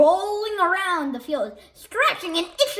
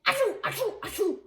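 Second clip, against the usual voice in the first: a string of comic sneezes; repeated sneezes; 3 semitones higher, 4 dB quieter, faster than usual. A narrator's reading. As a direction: sneeze and sneeze again. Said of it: "sneezed and sneezed".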